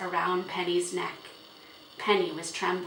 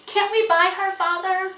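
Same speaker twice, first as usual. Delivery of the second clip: put-on high, loud girlish pleading; begging; 13 semitones higher, 10 dB louder than usual. A narrator's reading. Girl begging father to buy the horse, pleading. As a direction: plead with him at a high pitch. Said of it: "begged Felicity".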